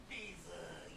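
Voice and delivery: whispered name Beazle. Real, not acted. Owner whispering his dog's name, softly.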